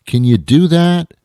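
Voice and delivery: scolding the audience to fake having books, loud; loud challenge. Real, not acted. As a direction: push them to do it.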